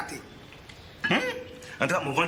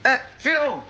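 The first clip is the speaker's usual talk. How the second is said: loud call across the house; 3 semitones higher, 5 dB louder, slower than usual. Real, not acted. Husband calling out for his wife, loud and fall-off. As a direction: shout her name to find her.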